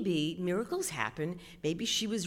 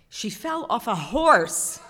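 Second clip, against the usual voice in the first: plain reading voice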